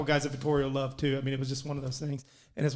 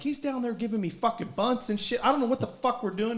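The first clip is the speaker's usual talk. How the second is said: mildly acted-out complaint, pitched up; exasperated griping; 7 semitones higher than usual. Player retelling his argument with the coach, exasperated griping. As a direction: gripe in a higher, fed-up voice.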